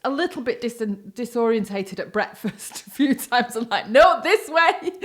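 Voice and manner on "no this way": calling out. Retold or imitated. Calling disoriented mother at breakfast.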